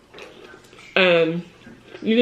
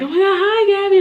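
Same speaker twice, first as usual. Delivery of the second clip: much higher, louder squeal of joy; so excited; 13 semitones higher, 5 dB louder than usual. Retold or imitated. Mom imitating her son greeting his aunt, high squeal. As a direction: burst out like a kid thrilled to see his aunt. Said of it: "like, so excited".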